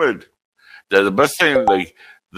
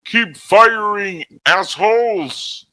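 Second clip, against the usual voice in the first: drawn-out high hammed-up order; slow high mock command; 12 semitones higher, much slower than usual. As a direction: bark it slowly through a helmet. Helmet on, Dark Helmet line.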